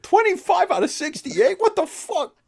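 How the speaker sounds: high squeaky disbelief; mock outrage. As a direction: play the indignant fan losing it. Imitating the outraged reviewer.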